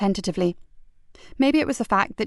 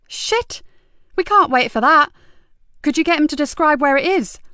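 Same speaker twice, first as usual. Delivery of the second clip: loud, higher urgent demand; 7 semitones higher, 5 dB louder than usual. Acted. DI on the phone to her sergeant, urgently. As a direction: press hard, no time to lose.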